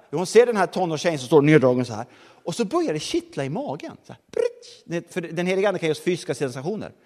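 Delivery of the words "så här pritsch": tiny high squeak like a tickle; squeaky tickle noise. Retold or imitated. Preacher miming a tickle in the stomach, high squeak. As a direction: make the tickle pop up high.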